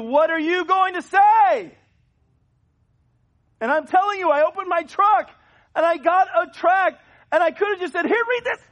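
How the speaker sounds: high, loud, climactic sermon shouting; full-force preaching. Acted. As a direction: build it up to a fiery pulpit peak.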